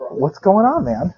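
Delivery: usual speaking voice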